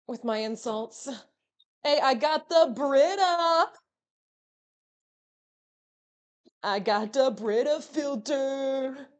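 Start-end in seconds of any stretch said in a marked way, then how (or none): 1.8-9.1 drawn-out, loud mock fanfare; hammy triumphant cry. Streamer showing off the Brita to chat, hammy triumph. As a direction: draw it out loudly with playful triumph. Hammy announcing of water filter.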